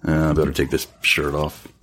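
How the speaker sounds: usual speaking voice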